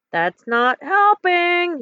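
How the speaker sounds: high, drawn-out yelled complaint; frustrated yell. A narrator's reading. Kermit to Bob amid the tornado, yelling in frustration. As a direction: stretch the last word in a high yell. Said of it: "yelled Kermit".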